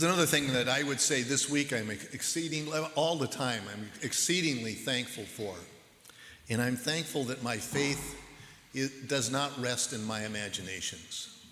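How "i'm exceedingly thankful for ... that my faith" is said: ordinary delivery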